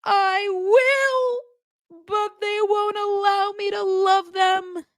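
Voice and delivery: very high, slow mock whine; pity-me falsetto, dragged; mocking Cody. Vlogger mimicking Cody blaming his kids, very high whine. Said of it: "And he goes".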